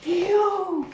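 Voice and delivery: sudden high yelp of surprise; jolted into a startled yo; shocked yo. Real, not acted. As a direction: caught off guard by a wild lyric, a high startled yo. Reacting in shock to a song.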